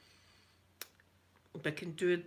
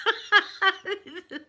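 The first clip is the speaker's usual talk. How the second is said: laugh; 11 semitones higher, 7 dB louder than usual. Real, not acted. Laughs about channel tips.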